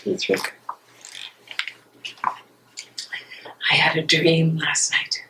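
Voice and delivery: slow, breathy whisper of a secret; hushed, slow whispered confession to her love. Acted. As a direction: draw him close and whisper it slowly. Intimate confession to Dietrich.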